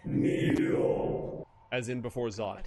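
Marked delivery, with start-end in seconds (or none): 0.0-1.5 unearthly voice. Shown as a demonstration. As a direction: repeat the breathy otherworldly command. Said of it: "what was that?".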